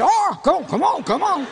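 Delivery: high, shouted imitation of airport staff; alarmed protest. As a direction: yell urgently to make him stop. Airport searchers stopping him undressing.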